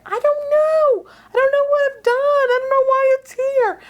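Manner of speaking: loud, very high imitated wail; panicked. Frightened friend on the phone to her adviser, panicked. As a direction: act out her helpless panic at full pitch.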